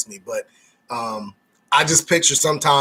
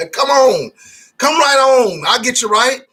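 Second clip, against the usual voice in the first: excited welcome; 8 semitones higher, 5 dB louder than usual. Pretend. Mock invitation to move.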